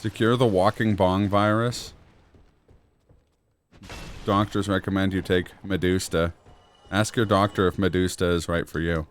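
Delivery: upbeat drug commercial voice; chipper 1950s ad. Pretend. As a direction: read it like a cheesy TV ad. Mock pharma ad read.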